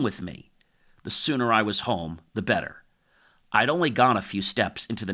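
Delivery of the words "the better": ordinary delivery, nothing marked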